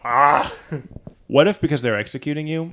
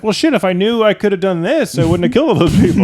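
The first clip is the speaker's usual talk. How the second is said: louder, higher indignant rant; mock outrage; 7 semitones higher, 7 dB louder than usual. Condemned man ranting to the squad, louder and higher. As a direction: rant as if cheated.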